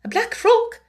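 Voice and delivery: high, flustered echo; timid, startled question; bewildered stammer. A narrator's reading. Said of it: "Miss Amelia stammered again".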